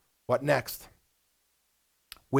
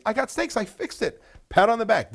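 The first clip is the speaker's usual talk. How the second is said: proud manager; 6 semitones higher than usual. Retold or imitated.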